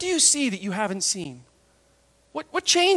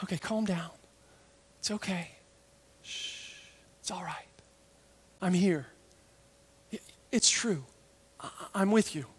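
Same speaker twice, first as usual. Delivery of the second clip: hushed, soothing voice with pauses; soft, unhurried comfort; calming reassurance; 3 semitones lower, 8 dB quieter than usual. Acted. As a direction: soothe frightened friends gently, almost whispering. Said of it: "Hey, don't be afraid".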